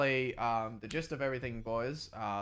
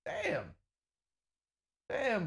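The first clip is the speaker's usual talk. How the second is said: excited; 12 semitones higher, slower than usual. Real, not acted. Hype train reaction.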